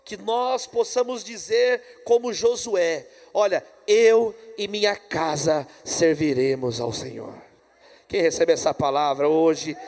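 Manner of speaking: loud, measured sermon climax; preaching. Real, not acted. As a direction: proclaim it to the congregation, loud and slow.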